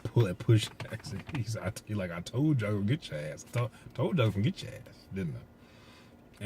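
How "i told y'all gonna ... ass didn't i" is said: retelling Thanos gloating at Thor, a bit higher; lightly voiced villain gloating; mock Thanos taunt. Thanos gloating over Thor, lightly voiced taunt.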